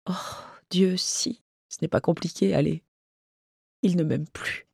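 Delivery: small, subdued voice after tears; quiet, halting admission; subdued confession. Acted. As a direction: softly admit heartbreak. Weeping friend confesses she is unloved.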